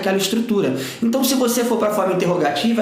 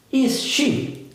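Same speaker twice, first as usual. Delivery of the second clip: "Is she?" pitched up as a curious question; question intonation; 5 semitones higher than usual. Shown as a demonstration. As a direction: model "Is she?" for learners, high questioning tune. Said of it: "Dá essa entonação de pergunta". ("Is she?" em tom de pergunta)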